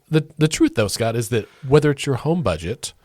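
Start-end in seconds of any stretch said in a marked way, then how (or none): none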